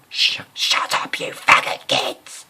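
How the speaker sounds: very loud slow shrill yell; music teacher shouting at kids cleaning up. Retold or imitated. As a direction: scream it at the class. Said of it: "He said".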